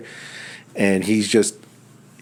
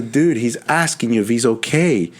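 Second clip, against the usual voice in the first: retold explaining; 6 semitones higher than usual.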